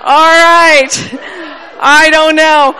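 laughing loud and high, words breaking up; can't get the words out for laughing. Real, not acted. To the congregation, laughing loud and high.

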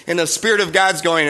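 normal talking voice

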